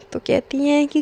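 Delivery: ordinary talk, nothing marked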